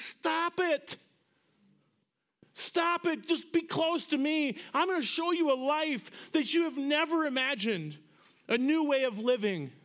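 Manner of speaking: high, pleading stop it; urgent loving plea. Acted. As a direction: implore them to come close. Jesus pleading with listener.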